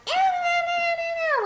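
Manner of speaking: high squeaky repeated eh, eh; annoying cartoon noise. Retelling a cartoon to friends, high squeaky annoying noise. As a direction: squeal it like a grating cartoon critter. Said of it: "this freaking annoying noise".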